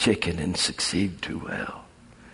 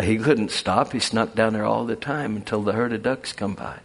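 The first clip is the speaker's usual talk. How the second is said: ordinary delivery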